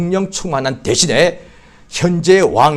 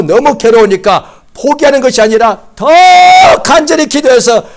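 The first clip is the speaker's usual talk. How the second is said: much higher, loud shouting climax; fervent shout; 8 semitones higher, 6 dB louder than usual. Real, not acted. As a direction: cry it out over the congregation. Preaching at full force. (더 간절히 기도하라고 회중에게 높이 외치는 설교)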